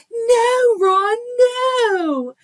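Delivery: very high, loud, drawn-out wail; hammed-up dramatic scream, stretching each NO; niece's movie cry. Aunt mimicking six-year-old niece's Harry Potter line, loud high wail. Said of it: "She goes".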